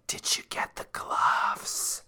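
slow, secretive whisper. Retold or imitated. Melanie asks Darlene about the gloves, whispering. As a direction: breathe the question like a secret.

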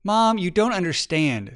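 a touch of overwhelmed protest; exasperated child. A narrator's reading. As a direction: protest as feelings start to take over. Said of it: "Her feelings were getting the best of her".

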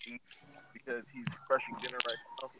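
teasing whispered aside; whispering. Real, not acted. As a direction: whisper it behind his back. Aside whisper about guest eating.

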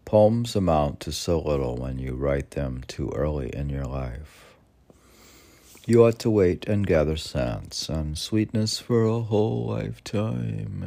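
loud, emphatic recital; poetry reading. Acted. Reading Rilke's lines on the podcast, loud declaiming. Reads Rilke passage aloud.

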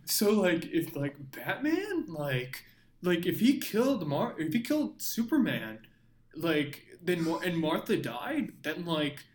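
hammed-up earnest fan, close to cracking up; mock superfan. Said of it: "They're like, you know".

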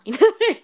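high, loud sudden laugh; bright embarrassed giggle burst; burst of laughter. Real, not acted. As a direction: laugh it out, amused at yourself. Laughing at own remark.

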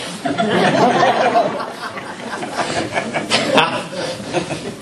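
a long, high, breathy burst of laughter; big laugh. Real, not acted. Preacher cracking up at his laundry joke, laughing hard.

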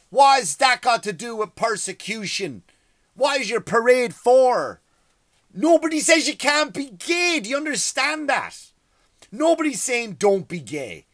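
ranting at pride parade loudly; angry rant. Real, not acted. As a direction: bark it at the parade crowd.

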